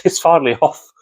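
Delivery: ordinary talk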